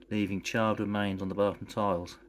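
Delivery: ordinary delivery, nothing marked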